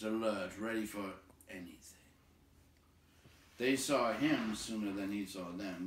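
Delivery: usual speaking voice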